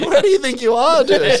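high, loud mock indignation; high, loud, mock-offended question; mock indignant Aussie. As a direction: scold like an offended tourist. Mocking complaining Aussie.

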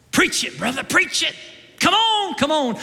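egging the preacher on from the pews; call back to the preacher. Retold or imitated. Old church member egging on the preacher, excited.

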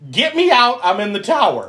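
high, emphatic retold demand; hammed-up frustrated shout; exasperated mock plea. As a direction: spell it out loudly to someone oblivious. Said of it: "she fucking told you".

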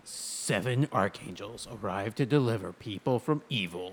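slow trailer narrator voice; movie trailer voice. Acted. Reading movie description dramatically.

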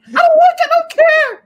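put-on very high, loud childish refusal; a hammed-up gift tantrum; mock tantrum. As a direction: shriek it like a toddler refusing a gift. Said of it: "That would be".

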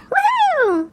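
high cheering woohoo; excited whoop. Real, not acted. Cohost cheering at the sign-off, high excited whoop.

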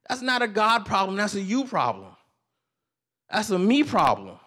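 loud, high-pitched preaching climb; preaching at full force. Acted. Pastor pressing the congregation, rising preaching. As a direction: build the point like a pulpit preacher.

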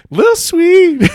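cracking up mid-word; laughing as he says the name. Real, not acted. Laughs saying team name.

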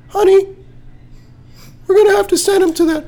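very high, loud falsetto parent; parent voice, octaves up. Acted. Parent breaking pet news to child, loud squeaky falsetto. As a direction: push a put-on parent's voice painfully high. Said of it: "And your parents said".

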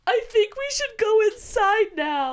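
lightly voiced hurt boy wanting to go in; hurt kid. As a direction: say it like a sore little kid. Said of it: "And he's like".

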